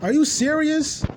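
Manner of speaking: high, drawn-out baffled reaction to the chat; mock disbelief. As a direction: stare at it in total disbelief. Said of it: "I'm just watching this like".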